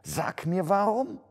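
high voice climbing to the end; trembling question. A narrator's reading. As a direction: ask fearfully, pitch rising sharply. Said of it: "murmelte Scrooge zitternd". (zitternde, hoch ansteigende Frage)

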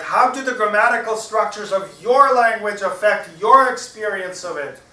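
loud, high-pitched parrot. A narrator's reading. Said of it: "says the parrot".

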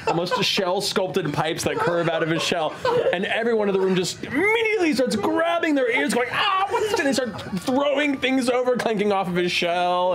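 loud, high, mock-straining narration; shouted narration. Game master describing bagpipes blasting the bar, loud high narration. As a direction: shout over imaginary bagpipes.

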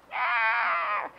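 long, drawn-out mock screech; mock war cry. Preacher picturing a crazy woman chasing Elijah, mock screech. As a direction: screech like a madwoman charging.

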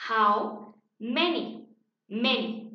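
"how many" drawn out word by word; teacher sounding out "how many", then "many"; pronunciation model. Shown as a demonstration. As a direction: slowly model "how many" for learners to copy. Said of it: "la pronunciación es".

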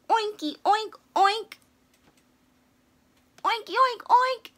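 high squeaky pig oinks; pig talk. Acted. Piggie greets everyone in pig, high and squeaky. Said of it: "That means Happy Pig Day in pig".

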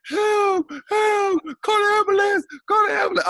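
very high, loud hammed-up cry for help; mock screaming victim. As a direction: fake the screams of a man pretending he got hit. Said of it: "Dude is screaming like".

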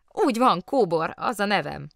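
merry, eager self-introduction; cheerful interjection. A narrator's reading. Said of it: "szólt közbe vidáman".

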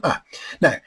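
usual speaking voice

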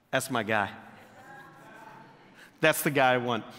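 low, soft, certain God voice; a calm deep verdict from above. Retold or imitated. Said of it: "God looks at him and goes".

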